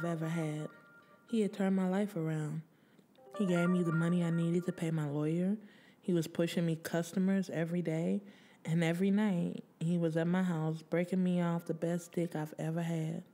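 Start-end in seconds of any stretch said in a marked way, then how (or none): none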